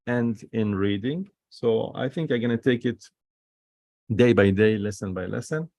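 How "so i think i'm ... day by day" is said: normal talking voice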